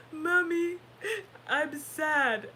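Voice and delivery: gasping, loud, high sobby words; imitated crying child. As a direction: mimic a little boy's tearful confession. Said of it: "he's crying because of something".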